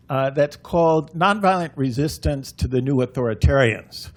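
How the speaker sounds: usual speaking voice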